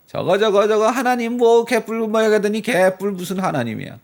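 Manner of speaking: loud, high jeering at a beaten man; mocking taunt. Neighbours taunting David all day, loud high jeer. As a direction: sneer at David, daring his God to show up. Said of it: "주변 사람들이 뭐라 그래요?".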